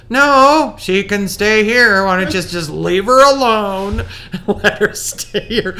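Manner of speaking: loud mock protest breaking into laughter; emphatic objection. Partner overrules her about the child, retold as emphatic objection.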